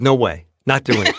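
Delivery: loud, joking flat-out no; mock firm refusal. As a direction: refuse loudly, playing it for a laugh. Retelling his refusal, jokingly.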